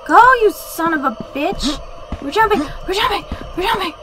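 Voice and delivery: panicked high screaming; scared horror game streamer. Real, not acted. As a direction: yell in real terror while fleeing. Monster chases streamer, screaming.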